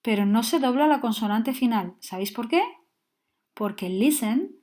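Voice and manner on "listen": "listen" with the last syllable left unstressed. Shown as a demonstration. Teacher explaining why the n is not doubled, stress on first syllable. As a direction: say "listen" with the weight on LIS. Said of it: "no tiene acentuada la última sílaba".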